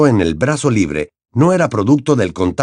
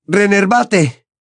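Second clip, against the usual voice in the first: howled spell; 10 semitones higher, much slower than usual. Acted. Harry casting at Dumbledore's chest, howling. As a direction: howl the spell out. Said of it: "aulló apuntándole al pecho".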